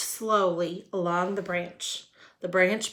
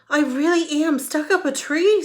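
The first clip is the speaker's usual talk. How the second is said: insisting; 8 semitones higher, 8 dB louder than usual. Acted. Wilbur insisting.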